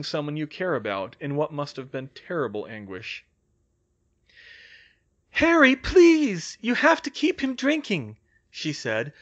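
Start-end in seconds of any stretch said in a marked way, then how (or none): 5.3-8.2 high, loud, pleading cry; frantic high-pitched pleading; desperate plea. A narrator's reading. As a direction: beg him urgently, voice pitched way up. Pleading with Harry to keep drinking.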